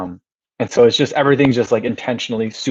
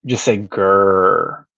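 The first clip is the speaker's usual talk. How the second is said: Greer drawn out slowly as "grr"; mock drawl of Greer as "grr"; slow local Greer; 4 semitones lower, much slower than usual. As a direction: stretch "grr" slowly like a Southerner. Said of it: "He goes".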